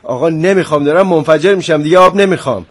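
worked-up, forceful outburst; angry. Acted. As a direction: refuse heatedly, about to blow. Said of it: "تو الان عصبانی هستی".

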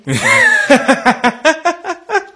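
high rapid-fire cackle; long, helpless burst of high laughter; uncontrolled peals of laughter; acted guffaw. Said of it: "با خنده و قهقه هم اجرا کنید".

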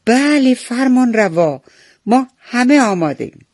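high, loud answer to the ruler; eager chorus reply. A narrator's reading. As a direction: call back as one obedient flock. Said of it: "پرنده ها همه با هم گفتن".

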